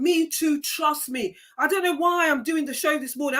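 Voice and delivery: normal talking voice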